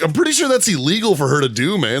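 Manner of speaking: retold high-pitched protest; mock outrage on a friend's behalf; indignant.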